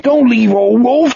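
a sobbing plea, voice way up; weeping plea. Acted. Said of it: "he wept".

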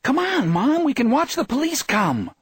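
put-on high-pitched eager young girl; excited urging. A narrator's reading. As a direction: urge Mom along in a high, excited voice. Excited girl urging her mother.

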